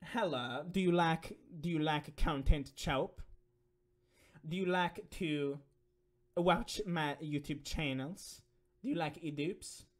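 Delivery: put-on slow, halting foreign accent; mock foreign accent with stretched-out phrases; iDubbbz foreign voice. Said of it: "foreign voice pretty easy".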